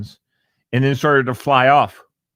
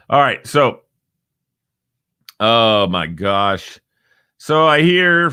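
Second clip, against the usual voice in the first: ordinary talk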